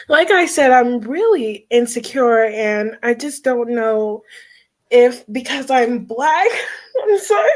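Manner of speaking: real tearful confession, breaking at the end; fighting back tears. Real, not acted. Insecure caller opening up to the host, fighting tears. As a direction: struggle through it until the crying breaks out. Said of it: "I'm trying not to ugly cry".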